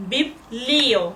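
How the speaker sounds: বিবলিও broken after বিব, with লিও drawn out; টেনে বলা. Shown as a demonstration. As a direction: hold the লি in বিবলিও long. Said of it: "একটু টেনে বললাম". (বিবলিও-র লিও অংশটা টেনে বলা)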